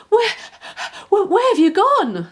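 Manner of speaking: high, loud calling out; bellowing. A narrator's reading. Puppy bellows for the vanished puppy. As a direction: call out all around the pond. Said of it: "Scamper bellowed".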